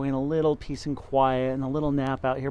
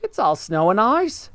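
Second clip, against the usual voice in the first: high bird voice; roly-poly bird; 8 semitones higher, 5 dB louder than usual. Acted. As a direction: chirp it like a friendly bird. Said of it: "said the roly-poly bird".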